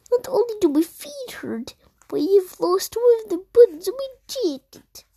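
high-pitched, grumbling toad voice; complaining Toad. Acted. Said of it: "voicing Toad".

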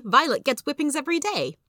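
put-on high-pitched small child's outcry; mock little-boy yell. A narrator's reading. Little brother accusing his brother, mock yelling. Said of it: "yelled Benny".

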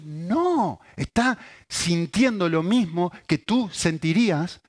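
high, loud, impassioned sermon voice; a pastor pleading from the pulpit; fervent preaching. Acted. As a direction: preach it with full fire. Preaching at full force.

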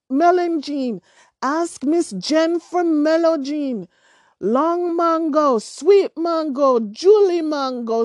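high, loud singsong calling of wares; market vendor calls. A narrator's reading. Vendor calling out to shoppers, loud singsong cry. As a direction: hawk the produce across the market.

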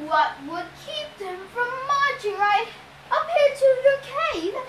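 high, anxious questioning voice; falsetto bride. A narrator's reading. Disguised captive asks the Cyclops about defences, anxious falsetto. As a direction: ask innocently in a put-on falsetto.

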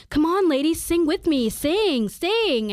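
loud, high, drawn-out goading; imitated pushy heckler. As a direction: call out like a man badgering people to join in. Imitates man pestering them to sing.

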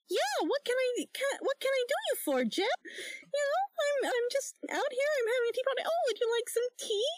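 very high cutesy cartoon voice; high-pitched, sweetly chatty cartoon lady; Betty Boop. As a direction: play hostess in a squeaky girlish coo. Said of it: "I'm trying to be, uh, Betty Boop".

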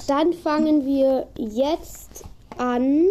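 very slow, stretched out; slow drawn start. Shown as a demonstration. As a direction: drag each word out. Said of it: "Oh, äh".

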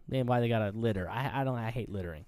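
ordinary talk, nothing marked

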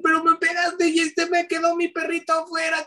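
put-on high tearful child's voice complaining; a daughter protesting tearfully to dad; upset little girl. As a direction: whine like a hurt small daughter. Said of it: "empecé a decir con mucho sentimiento".

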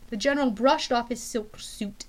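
plain reading voice

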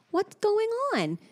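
put-on high, slow little-girl voice; imitating a curious toddler. Mom quoting her toddler's question.

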